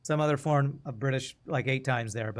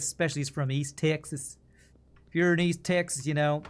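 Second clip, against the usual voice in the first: retired Texas farmer. Shown as a demonstration. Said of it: "gonna probably sound something more like this".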